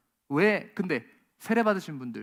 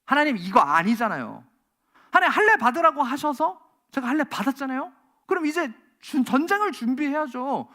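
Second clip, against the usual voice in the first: mock pushy demand; 6 semitones higher, 5 dB louder than usual. Preacher voicing arrogant believer.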